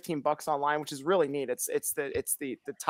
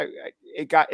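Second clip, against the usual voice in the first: usual speaking voice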